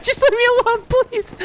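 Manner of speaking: very high, frantic begging yell; panicked pleading. Real, not acted. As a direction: beg for mercy while running. Chased by monster, begging.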